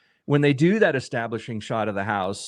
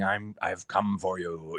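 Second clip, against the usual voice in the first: softer, slow sinister threat; menacing horror figure, lightly done; ominous; 4 semitones lower, 8 dB quieter than usual. Retold or imitated. Host mocking an overt horror villain, slow ominous threat. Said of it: "being ominous and saying".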